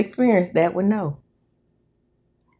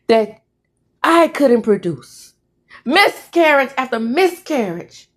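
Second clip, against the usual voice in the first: emphatic, anguished reproach; hurt, accusing wife; 6 semitones higher than usual. Acted. Wife reproaching husband over lost babies, hurt. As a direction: press the questions with rising hurt. Acts wife grieving miscarriages.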